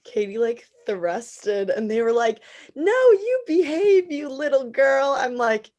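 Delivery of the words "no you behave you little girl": high, loud, drawn-out scolding imitation; mock scolding. Host mimics castmates telling Katie off, mock scolding.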